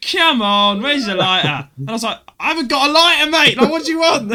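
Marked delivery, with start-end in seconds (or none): 0.1-1.7 high, loud, exasperated wheedle; mock pestering plea. Essex stranger pestering a passer-by for a lighter, mock exasperated plea. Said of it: "Like that". 2.4-4.3 loud, high-pitched mock protest; exasperated retort. Passer-by pestered for a lighter, acting out an exasperated retort. Retells arguing back over a lighter.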